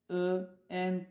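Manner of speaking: usual speaking voice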